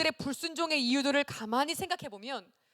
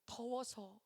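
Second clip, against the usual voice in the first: small, much softer reply; sniffly child, imitated; 9 dB quieter, much slower than usual. Little girl answering her carer after crying, small and subdued. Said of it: "훌쩍훌쩍 막 눈물을 닦더니".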